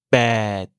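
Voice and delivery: "bête" low, long È, unblown P, silent T; low tone, unaspirated P. Shown as a demonstration. Teacher introducing Thai eight, low long "bête" with unaspirated P. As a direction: draw "bête" out low and cut the T. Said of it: "Le P se prononce sans souffler d'air".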